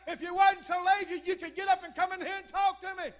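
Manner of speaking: high-pitched mock yell; imitated scream back. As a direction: act out a wife hollering back across the house. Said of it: "And she'd scream back".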